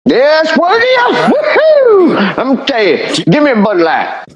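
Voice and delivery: high, whooping mock yokel; high hooting caricature of a cheering American. Mocking American redneck cheering.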